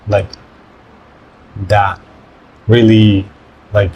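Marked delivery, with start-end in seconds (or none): 0.1-2.0 soft Spanish da, tongue lightly touching; da said with a gentle soft D. Shown as a demonstration. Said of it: "that would be like a soft D".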